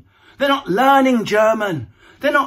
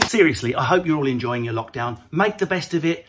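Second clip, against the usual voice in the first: ordinary talk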